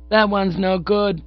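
put-on high, slow little-kid voice; freckled child protesting. A narrator's reading. Child tells pop an actor is no good, high protest. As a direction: object in a drawn-out child's pitch. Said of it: "had risen to lodge a protest".

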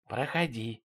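soft whispered invitation; quiet, secretive murmur; hushed. A narrator's reading. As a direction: invite in under your breath. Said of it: "по-прежнему не повышая голоса".

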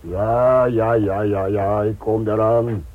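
calling out. Acted. Bill calls out that he's coming. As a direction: call back that you're coming. Calling back while hurrying off.

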